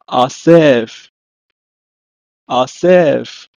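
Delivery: asef in two careful syllables, falling; Hebrew pronunciation. Shown as a demonstration. Teacher gives the Hebrew for gather, careful asef. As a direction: model "asef" clearly, then repeat. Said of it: "pronounced as".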